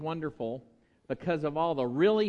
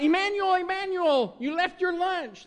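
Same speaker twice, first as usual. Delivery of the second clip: much higher, fast, carrying call; mom calling after kid; 14 semitones higher, 4 dB louder than usual. Pretend. Pretend mother calling after child about lunch, high call. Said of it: "Doesn't work very well either".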